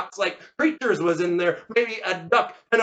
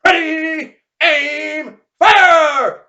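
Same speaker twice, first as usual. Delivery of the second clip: high, long-held calls; shouted firing command; 9 semitones higher, 9 dB louder, much slower than usual. Acted. Veteran to seven gunmen, shouted firing orders. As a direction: bellow each order, stretching it out.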